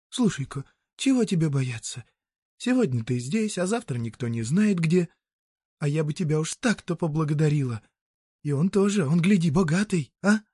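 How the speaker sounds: high-pitched woman coaxing; persuading peasant woman. Acted. Woman offering a passer-by a job, high and coaxing. Voices Marya in story.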